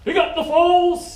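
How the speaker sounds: loud, high bellowed command; barked order. Retold or imitated. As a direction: bark it across the deck like a petty officer. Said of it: "And, and he says".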